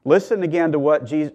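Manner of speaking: ordinary talk, nothing marked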